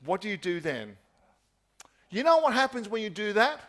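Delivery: normal talking voice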